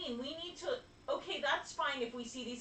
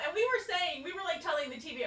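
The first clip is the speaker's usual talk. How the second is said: ordinary talk, nothing marked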